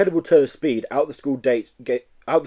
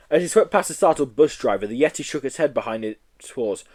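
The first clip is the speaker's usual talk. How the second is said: ordinary delivery, nothing marked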